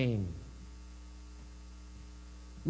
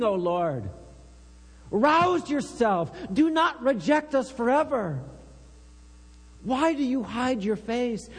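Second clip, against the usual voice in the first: fervent psalm reading; 5 semitones higher than usual. Acted. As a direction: cry the psalm out to God as your own. Reads Psalm 44 lament.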